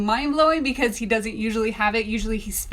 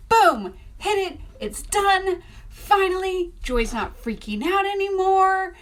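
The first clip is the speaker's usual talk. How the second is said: triumphant and pitched higher; excited; 10 semitones higher than usual. Retold or imitated. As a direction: celebrate finally being done. Triumph at finishing.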